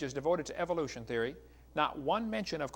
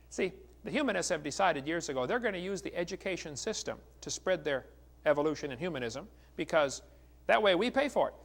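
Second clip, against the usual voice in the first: ordinary talk, nothing marked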